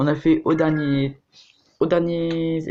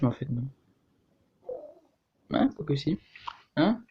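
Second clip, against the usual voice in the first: ordinary talk, nothing marked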